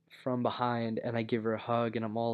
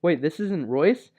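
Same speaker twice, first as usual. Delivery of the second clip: higher, louder startled question; surprised; 4 semitones higher, 7 dB louder than usual. Retold or imitated. The sister realizing it's not Royce, surprised. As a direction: her shock at the wrong hug, rising surprised question. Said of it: "she's like".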